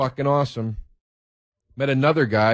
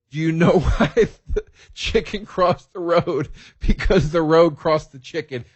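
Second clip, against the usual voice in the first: retold yell; 4 semitones higher than usual. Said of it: "And I yelled".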